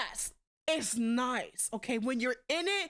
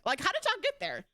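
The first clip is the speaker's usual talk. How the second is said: higher, put-on disbelieving voice; incredulous; 6 semitones higher than usual. Shown as a demonstration. Questioning people's logic, in mock disbelief. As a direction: ask it like you can't believe it.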